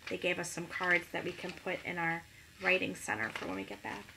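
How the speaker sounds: usual speaking voice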